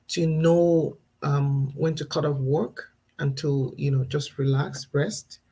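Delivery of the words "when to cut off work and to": ordinary delivery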